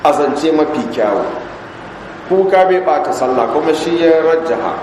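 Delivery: ordinary talk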